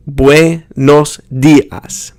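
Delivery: buenos dias split slowly, bweh-nos dee-ahs; forced syllables. Shown as a demonstration. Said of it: "each syllable".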